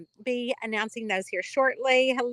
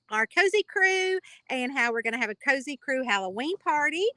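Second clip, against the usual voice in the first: normal talking voice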